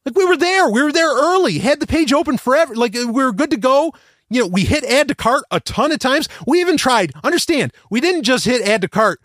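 high, worked-up indignant rant. Real, not acted. Ranting after losing the sale.

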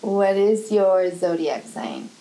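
ordinary talk

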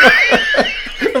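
high squealing laugh; shrieking laugh. Real, not acted. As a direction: burst into a shrill laugh. Burst of laughter.

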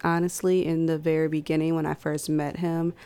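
usual speaking voice

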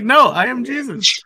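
mock insistence. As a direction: play an indignant Jesus, pitch lifted. Acts Jesus insisting on his identity.

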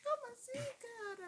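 put-on high, slow, soft teen squeak; a hint of a breaking teen voice; teen voice cracking. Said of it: "Aquela voz de adolescente".

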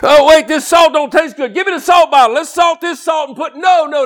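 high, loud shouting preacher; fiery pulpit shouting; full-force preaching. Acted.

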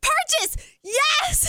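high-pitched excited mock yell; squealed, reenacted shouting. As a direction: cry it into the phone, pitch soaring. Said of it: "I'm yelling into the phone".

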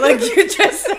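laughing hard; cracking up mid-sentence. Real, not acted. Laughing at mom's noise.

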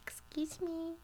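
tiny, high, soft voice; trapped man's voice. Retold or imitated. Buried man to anyone outside, small high polite call. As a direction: pipe up softly, imitating someone shut in. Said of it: "all of a sudden he's like".

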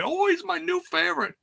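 very high-pitched, fake fangirl squeal of praise; mock gushing fan. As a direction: gush in a sarcastically put-on high voice. Mocks a gushing fan reaction.

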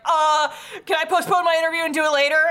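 loud, high, rushed mock panic; imitating a flustered girl dodging; panicked excuse. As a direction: blurt it fast to get out of it. Acting panicked character dodging.

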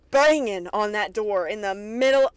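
usual speaking voice

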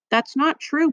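protesting. A narrator's reading. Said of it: "protested Tom".

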